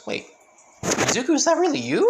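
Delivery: high, loud disbelieving question; can't believe it; amazed. Acted. Naruto realising it's really Izuku, high amazed question.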